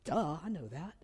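imitated kid stating the obvious; obvious, duh tone. Pastor re-voices the boy's answer, as if obvious. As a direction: toss it off like the sky is blue. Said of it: "like it was the sky is blue".

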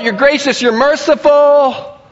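high, loud sulky whine; pouting. Acted. Jonah pouting outside the city at God, a loud high whine. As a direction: whine it like a sulking child. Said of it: "he's pouting and he's saying".

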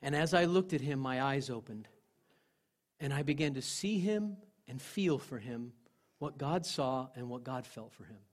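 ordinary talk, nothing marked

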